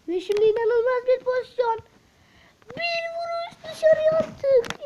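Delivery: loud, high, breathless match commentary; screaming football commentator. Acted. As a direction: call the goal like a live broadcaster. Commentates his own match replay.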